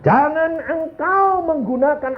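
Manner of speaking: high, slow sustained exhortation; ringing sermon admonition; fervent preaching. Real, not acted. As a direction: declaim the warning slowly on a high pitch. Preacher warns congregation.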